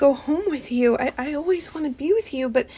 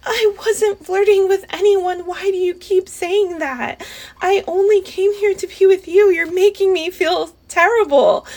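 louder and higher, hurt and defensive; tearful raised protest; upset outburst; 5 semitones higher, 6 dB louder than usual. Acted. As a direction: burst out, stung by the accusation. Heated argument with boyfriend.

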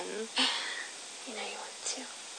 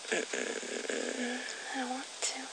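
teasing whisper; 13 dB quieter, slower than usual. Acted. Teasing partner refuses playfully.

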